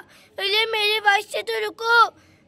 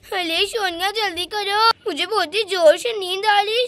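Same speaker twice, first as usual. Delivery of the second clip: ordinary talk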